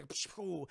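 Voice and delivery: soft imitated blast; mouth-made kaboom; explosion noise. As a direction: puff out a little explosion.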